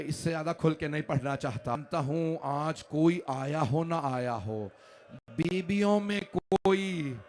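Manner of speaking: usual speaking voice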